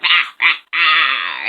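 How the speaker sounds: loud cawing rahs; raven caws. A narrator's reading. Ravens calling to each other, loud caws. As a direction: caw like a raven. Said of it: "They're like".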